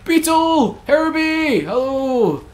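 high call across the street; calling after a runaway. Real, not acted. Player calling after a fleeing pedestrian, high call.

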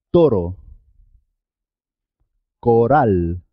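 single tapped R in toro and coral; simple R. Shown as a demonstration. Teacher gives examples of simple R, one tap each. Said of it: "y suena simple".

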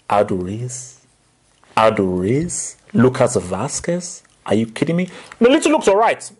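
normal talking voice

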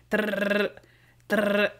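rolled jackhammer trill; short burst of mimicked drilling noise; imitated jackhammer. Retelling the jackhammer scene to a friend, imitated jackhammer. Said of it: "the jackhammer scene".

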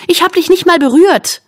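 high, indignant self-defence; snarling. A narrator's reading. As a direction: protest angrily at a high pitch. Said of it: "faucht Anna". (empört fauchend)

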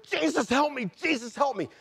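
high, urgent plea for rescue; retold scream, pitched well above normal; re-enacted screaming. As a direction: call out desperately, acting out the panic. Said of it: "And I'm just screaming".

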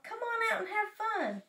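high, singing-out invitation; eager coaxing. A narrator's reading. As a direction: coax a friend out to play, pitched high. Frog coaxes Toad outside.

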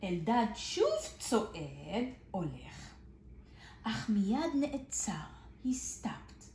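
lively storybook reading with big swings; reading to children. Acted. Teacher reads a picture book to small children, lively and expressive. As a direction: read it out like a kindergarten story.

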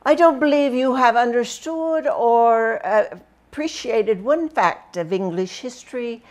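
lightly voiced wry old professor; teacher's comment. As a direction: read it as a wry history teacher grading. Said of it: "he'd write things on my paper like".

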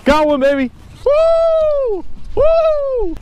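whooping with excitement, high; thrilled yelling as the fish bites; fighting a fish. Real, not acted. Hooked a salmon, cheering.

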